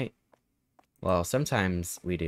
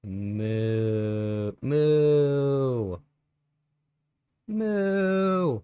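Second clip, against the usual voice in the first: rising moos; 5 semitones higher, much slower than usual. Shown as a demonstration. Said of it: "Was that good?".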